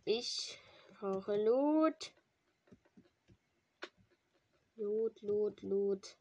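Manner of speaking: slow, stretched pleading for loot; drawn-out begging. Pretend. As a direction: beg the game slowly and loud. (langgezogenes Betteln)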